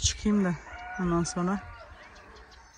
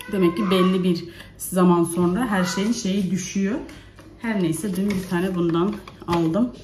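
ordinary talk, nothing marked

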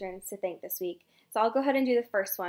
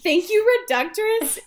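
excited thanks; 10 semitones higher, 8 dB louder than usual. Real, not acted. Echoes thanks exuberantly.

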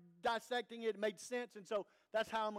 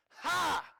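loud, high taunting "Ha!"; mocking laugh; 7 semitones higher, 5 dB louder than usual. Satan gloating over the fallen believer, a loud jeering "Ha!". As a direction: crow over him with a sharp jeering laugh.

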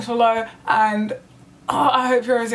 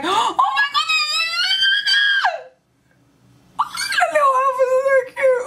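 very high-pitched delighted shriek; excited squeal. Real, not acted. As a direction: squeal over something unbearably cute. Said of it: "I am so excited".

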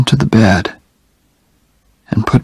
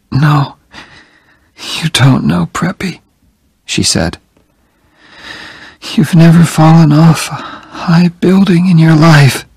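light, higher teasing voice; teasing dying woman; 7 semitones higher than usual. A narrator's reading. Jenny teasing Oliver from her bed, higher and light. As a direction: tease him gently though weak.

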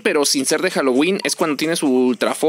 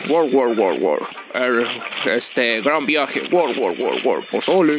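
lower, guttural mock alien babble; lightly voiced alien chatter, lower; alien gibberish; 5 semitones lower, slower than usual. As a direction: gargle it like a movie alien talking. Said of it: "y ellos están".

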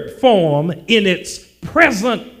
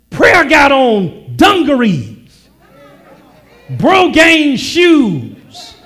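high, ringing pulpit proclamation; raised preacher's cry, phrase by phrase; full-force preaching; 7 semitones higher, 6 dB louder, slower than usual. Real, not acted. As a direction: punch each phrase out to the congregation.

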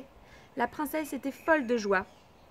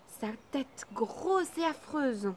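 plain reading voice

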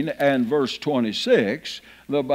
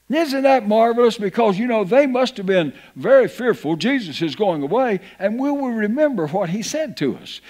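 higher, louder, rising fervor; fervent preaching; 7 semitones higher, 4 dB louder than usual. Acted. Preacher urging the church to marvel at the promise, fervent preaching. Preaching builds to full force.

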